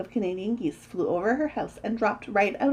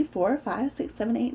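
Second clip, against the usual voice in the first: ordinary delivery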